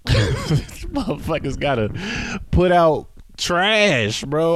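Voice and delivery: laughing. Real, not acted. Laughing rant, high and slow.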